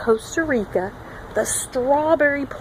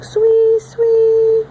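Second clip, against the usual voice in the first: high, loud swee whistles; high loud whistled swee; whistle call; 11 semitones higher, 7 dB louder than usual. Shown as a demonstration. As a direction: whistle it like the frog.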